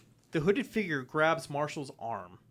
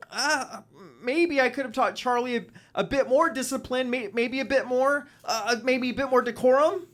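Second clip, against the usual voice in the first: higher, louder strained rambling excuse; pained slacker; 8 semitones higher, 7 dB louder than usual. Acted. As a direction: fumble an apology while hurting. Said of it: "And then he says".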